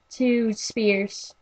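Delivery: ordinary delivery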